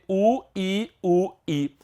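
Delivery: lightly sung U, I; spelled-out letters. Shown as a demonstration. Teacher spelling for the class. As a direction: say the letters U and I apart. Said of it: "os dicionários também trazem".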